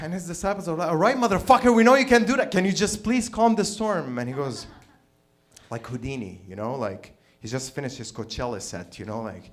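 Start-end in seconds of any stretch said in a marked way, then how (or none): 0.9-4.2 loud, high-pitched fed-up outburst; exasperated shout. Acted. Disciples begging Jesus to calm the storm, exasperated shout. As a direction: yell it, then ease into a plea.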